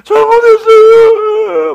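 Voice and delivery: loud, very high, drawn-out wail; hammed-up crying apology; tongue-tied begging. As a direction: imitate a terrified robber pleading. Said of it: "말도 안 나오고".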